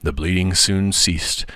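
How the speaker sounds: plain reading voice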